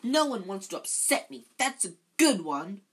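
high, loud indignant wail; howling. A narrator's reading. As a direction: howl it like a wronged ghost. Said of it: "howled Myrtle".

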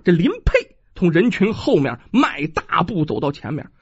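ordinary delivery

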